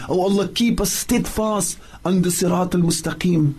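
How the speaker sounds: normal talking voice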